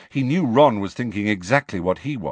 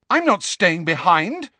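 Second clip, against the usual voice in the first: furious; 10 semitones higher, 4 dB louder than usual. A narrator's reading. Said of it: "said Hermione furiously".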